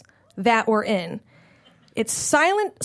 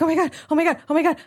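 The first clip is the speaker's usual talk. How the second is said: panting; 4 semitones higher, much faster than usual. Retold or imitated. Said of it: "I'm, like, panting".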